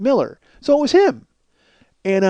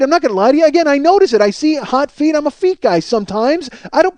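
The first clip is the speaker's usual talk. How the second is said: normal talking voice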